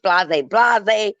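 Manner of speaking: ordinary talk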